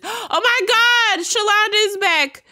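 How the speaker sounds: gasping high and loud; sudden thrilled outburst, way up; big excited squeal of surprise. Real, not acted. Gasps on seeing her return.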